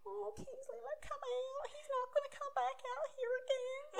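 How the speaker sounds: very high mock-crying voice; high, wailing impression of coaxing a pet; imitated tearful pleading. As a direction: plead in a hammed-up sob. Imitates tearful Brittany calling scared dog.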